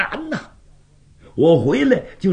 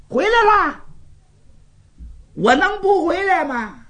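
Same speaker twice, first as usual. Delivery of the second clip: indignant retort; 13 semitones higher than usual. Acted. Jiang Ping bursts in, indignant.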